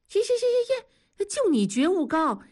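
high-pitched, exasperated "yeah, yeah"; sarcastic, high-voiced concession; mocking brush-off. Friend scoffs at being criticized.

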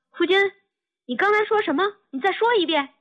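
high-pitched, urgent questioning; astonished wife, pitch leaping up; hurried disbelief. A narrator's reading. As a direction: ask urgently as if doubting your own ears. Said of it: "他不敢相信自己耳朵呀， 就赶忙说".

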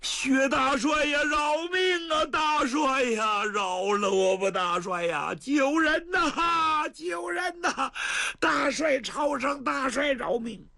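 slow, drawn-out high wails begging for his life; crying for mercy. Acted. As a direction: howl and plead like a doomed man. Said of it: "现在看你又喊又叫，又哭又闹". (拖长声哭喊求饶)

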